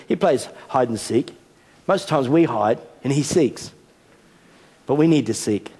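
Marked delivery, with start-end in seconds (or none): none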